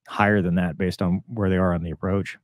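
usual speaking voice

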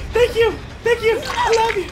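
high, loud squealed thanks; effusive relief. Pretend. Player just rescued, high effusive squeal.

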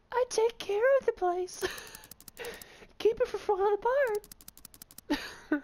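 very high falsetto woman; man doing a woman's voice. Pretend. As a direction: squeak like an old caretaker lady. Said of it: "such a bad girl's voice".

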